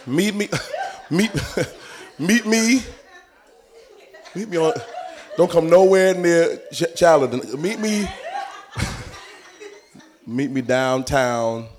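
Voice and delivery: hammed-up dealer setting a meetup; mock dealer on phone. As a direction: caller laughing through a shady pitch.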